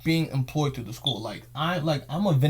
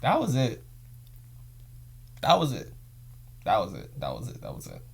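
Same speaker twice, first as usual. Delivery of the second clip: each "That was it." lower and softer until it is nearly gone; fading repetition; 5 semitones lower, 5 dB quieter than usual. Real, not acted. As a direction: let "That was it." die away over and over. Repeats the phrase, fading away.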